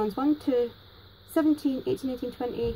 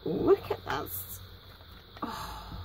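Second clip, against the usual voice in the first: breathless, hushed gasp of wonder; awed whisper; 5 semitones higher, 7 dB quieter, much slower than usual. Real, not acted. Finding another handmade card, whispering in awe. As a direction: whisper it like you can't believe it.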